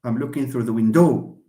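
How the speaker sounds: "window" wrongly stressed as win-DOW; misplaced final stress. Shown as a demonstration. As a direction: put the weight on the end of "window". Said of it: "غضحكوا عليا لو قلت كنهضر هكا".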